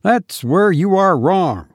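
mild sharp rebuttal; sharply. A narrator's reading. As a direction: cut him off sharply. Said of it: "said Eustace sharply".

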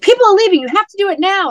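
high, loud hurried urging; urgent mock friend. Friend rushing her to start the speech, urgent and loud. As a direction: push her to the mic, people are leaving.

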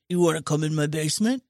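low, creepy, fast killer voice; creepy Buffalo Bill voice. Retold or imitated. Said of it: "and this is how he talks".

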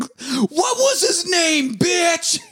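slow, high-pitched taunting shout; hammed-up screamed challenge; mock furious yell. Rocco's rant acted out.

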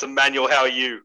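ordinary talk, nothing marked

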